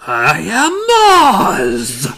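slow, drawn-out grand wizard proclamation with a laugh; hammed-up Oz announcing himself; booming Oz. Oz booming at the travellers, slow and grand.

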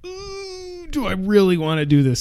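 whiny fake-crying voice; hammed-up dread, half sobbing; mock whimper. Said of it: "I'm like".

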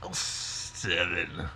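put-on slow half-hearted Australian accent. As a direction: drawl it in weak Australian. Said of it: "You have to do it in an Australian accent".

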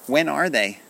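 high, loud, urgent question; excited cry. A narrator's reading. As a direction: ask like a child who cannot wait. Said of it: "cried Kenny".

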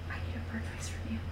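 whispered request; whispering. Real, not acted. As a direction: breathe it, no voice.

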